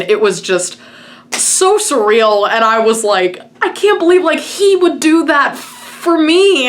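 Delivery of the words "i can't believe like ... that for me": high, slowed, overwhelmed retelling; elated disbelief. Retold or imitated. Fan reliving the surreal shout-out, elated disbelief. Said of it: "I was just elated with emotion".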